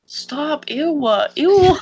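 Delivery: high, loud disgust. Real, not acted. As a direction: recoil in disgust. Disgusted at toe talk.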